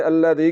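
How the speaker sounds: hissy z in place of dh in "अल्लज़ी"; whistling za. Shown as a demonstration. Teacher showing students the mistake, buzzing whistled z. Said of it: "आपने जा को पढ़ दिया".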